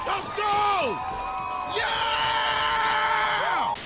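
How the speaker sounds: high triumphant cheering. Real, not acted. Streamer cheering as the troll leaves chat, high shrieks. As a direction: celebrate like a goal was scored.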